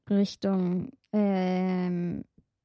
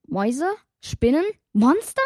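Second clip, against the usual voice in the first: each guess louder and higher than the last; rising fearful guesses; 6 semitones higher, 7 dB louder than usual. Acted. Girl guessing monsters, getting louder and more scared. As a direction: panic climbing with every word. Guessing what she hides from.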